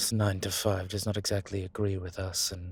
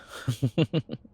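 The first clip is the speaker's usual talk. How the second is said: short, higher, louder chuckle; pleased chuckle; 5 semitones higher, 5 dB louder than usual. Acted. Vampire delighted at a clever accomplice, pleased chuckle. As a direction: chuckle in a quick run of pulses.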